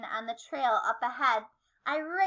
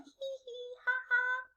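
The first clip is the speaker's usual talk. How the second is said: high mock giggle; squeaky little hee-hee ha-ha; giggling; 10 semitones higher, 7 dB quieter than usual. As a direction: titter like a playful kid. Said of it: "My classmates all giggled".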